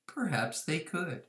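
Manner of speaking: ordinary delivery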